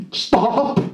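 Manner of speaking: a high, sudden cry of stop; sharp stop. Retold or imitated. Startled husband snapping stop at his partner. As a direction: cut her off with a high stop. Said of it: "That's what I said".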